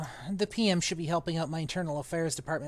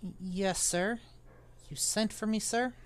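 lightly voiced boy, softer, stammering start; nervous boy; 3 semitones higher, 6 dB quieter than usual. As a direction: answer the stern master timidly. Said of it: "the boy's voice was steady".

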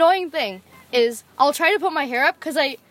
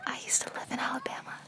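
whispered fun fact; 16 dB quieter than usual. Acted. Girl whispering her Alabama fact to friends. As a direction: breathe it as a secret whisper.

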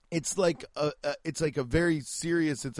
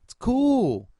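impressed tourist; 7 semitones higher than usual. Retold or imitated. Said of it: "I was like".

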